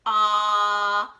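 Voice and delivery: a loud, open "uh" vowel; short O sound. Shown as a demonstration. Teacher demonstrating the O vowel sound to kids. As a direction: show the short O. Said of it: "the O says".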